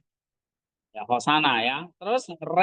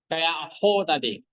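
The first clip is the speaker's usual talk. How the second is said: usual speaking voice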